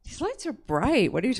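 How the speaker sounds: ordinary talk, nothing marked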